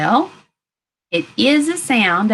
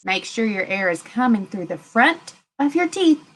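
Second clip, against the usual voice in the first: ordinary talk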